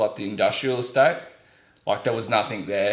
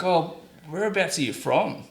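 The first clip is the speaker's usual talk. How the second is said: higher-pitched friendly inquiry; retold curious question; 7 semitones higher than usual. Factory man to Australian visitor, curious and higher.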